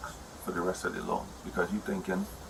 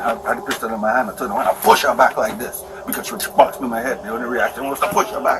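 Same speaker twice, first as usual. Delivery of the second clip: heated retelling; 2 semitones higher, 8 dB louder than usual. Retold or imitated. Angrily retelling being hit.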